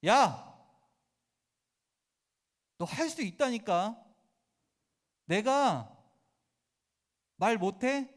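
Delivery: angry God. Retold or imitated. Preacher voicing God angrily shouting at stubborn Moses. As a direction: bark at him like an exasperated father. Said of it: "하나님이 화를 내십니다".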